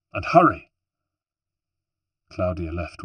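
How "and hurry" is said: raised, higher urging voice; urgent order. Acted. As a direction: send them off with urgency. Urges sister to get ready.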